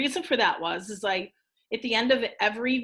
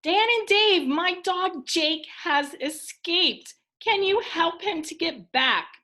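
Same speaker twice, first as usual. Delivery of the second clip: higher-pitched storybook plea; worried Missus Crane; 7 semitones higher than usual. Acted. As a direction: read her plea for help in a lifted voice.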